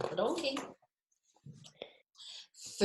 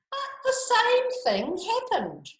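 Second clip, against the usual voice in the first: much higher, loud storyteller surprise; high, bright read-aloud surprise; surprised narration; 4 dB louder than usual. A narrator's reading. Suspense narration.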